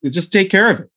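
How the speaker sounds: high, mock-exasperated boss; imitated impatient order; frustrated. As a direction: brush it off like a fed-up old boss. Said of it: "he was getting frustrated with them".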